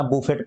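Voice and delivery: "बुफेट" with "boo" first and a sounded t; wrong pronunciation. Shown as a demonstration. Teacher quoting a misreading for learners, "बुफेट" with audible final t. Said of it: "नहीं पढ़ते हैं ना".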